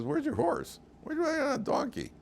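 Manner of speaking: squeaky mock official taunt. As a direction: needle him like a jeering official. Officials mocking Bilam.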